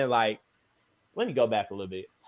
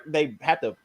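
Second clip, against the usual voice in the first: ordinary delivery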